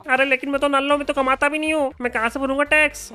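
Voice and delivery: high fast pleading villager. Acted. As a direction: squeak out a worried protest. Villager voiced by sarpanch actor.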